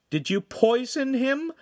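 slow, very high, shocked question; alarmed. A narrator's reading. The Signora asks if they poisoned him, alarmed. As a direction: ask it aghast.

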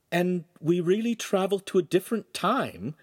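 high-pitched, wanting to be reassured; seeking assurance. A narrator's reading. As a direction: ask as if needing confirmation. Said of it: "seeking assurance".

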